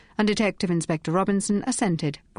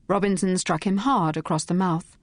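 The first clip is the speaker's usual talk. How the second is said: ordinary delivery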